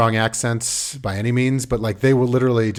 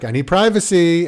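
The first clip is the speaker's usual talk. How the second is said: higher, louder mock complaint; retold exasperated plea; 7 semitones higher, 5 dB louder than usual. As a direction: act out an annoyed parent pleading. Retold plea to intruding kids.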